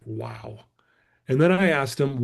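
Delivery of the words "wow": awed hushed wow. Retold or imitated. Stunned by the blind friend.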